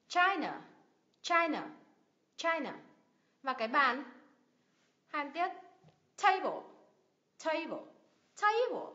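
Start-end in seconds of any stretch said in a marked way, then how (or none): 0.1-2.7 CHI in "China" high, second syllable dropping; first-syllable stress. Shown as a demonstration. Teacher models China for learners, first syllable stressed. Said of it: "nhấn âm tiết thứ nhất nào". 6.2-8.9 TA in "Table" high, ending falls away; first-syllable stress. Shown as a demonstration. Teacher drills Table for learners, first syllable stressed. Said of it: "âm tiết thứ nhất".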